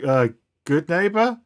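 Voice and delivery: hesitant, rising into an unsure question; meek response. Acted. Half the street answering their leader, meek and unsure. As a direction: answer timidly, "neighbor" climbing high at the end. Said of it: "meekly respond".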